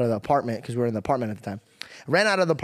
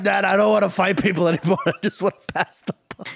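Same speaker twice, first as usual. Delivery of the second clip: higher, louder mock-tearful kid; mock crying child; 5 semitones higher, 3 dB louder than usual. Teasing as a ten-year-old begging Dad, mock crying. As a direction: plead like a crying kid, breaking into laughter. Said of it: "Ten-year-old kids crying".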